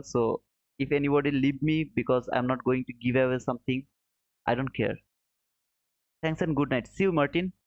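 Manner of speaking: ordinary talk, nothing marked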